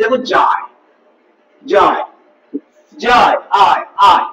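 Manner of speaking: যায় then আয়, each syllable drawn out slowly; syllable sounded out. Shown as a demonstration. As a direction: stretch যায় and আয় slowly so the vowel glide is heard. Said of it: "এর উচ্চারিত রূপ দেখো কেমন হবে". (ধীরে ধীরে যায়, আয় উচ্চারণ)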